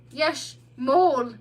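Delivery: slow, slurred, tipsy yes; blissful. A narrator's reading. Drunk sleepy otter answering the mole, blissful slur. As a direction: slur it happily half asleep. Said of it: "he said blissfully".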